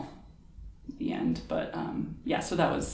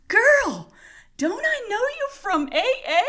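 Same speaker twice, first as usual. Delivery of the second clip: very high, hollered mock recognition; agitated patient; 4 dB louder than usual. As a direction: imitate a wild patient loudly calling out an acquaintance. Patient's retold call-out.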